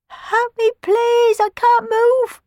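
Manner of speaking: faint voice. Acted. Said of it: "came a faint voice".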